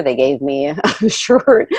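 usual speaking voice

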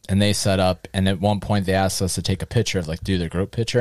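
ordinary talk

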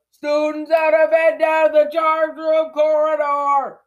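full-volume high, stretched-out alarm call; sustained high yell; bellowing. Acted. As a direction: bellow it down the corridor. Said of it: "Peeves bellowed".

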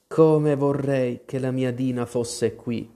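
plain reading voice

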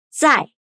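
sharp falling tone; 在 as zài, high falling fourth tone; Mandarin zài. Shown as a demonstration. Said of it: "De novo".

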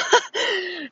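high burst of laughter; real laugh. Real, not acted. Telling viewers about strong contractions, amused high laugh. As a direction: laugh at your own ordeal.

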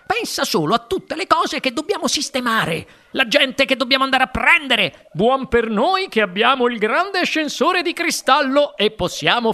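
high-pitched, bubbling eccentric host; excitable showman in a high voice; exuberant factory owner, overjoyed. A narrator's reading. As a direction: gush with congratulations and eager plans.

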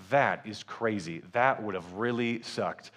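low, slow, soft understatement; deadpan reaction. Retold or imitated. Family watching the plane-fire news, deadpan. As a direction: react slowly, oblivious to the irony.

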